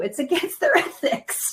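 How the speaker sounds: amused, laughter interrupting the words; laughing. Real, not acted. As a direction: say it through a laugh. Joking about telling people what to do.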